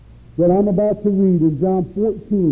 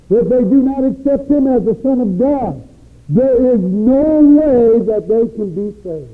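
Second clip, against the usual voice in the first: full-force preaching; 4 semitones higher, 3 dB louder than usual. Acted.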